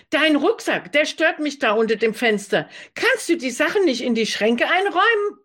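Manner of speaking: high, complaining tone; bossy roommate. Acted. Roommate griping about the backpack, high and nagging. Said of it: "tönte sie".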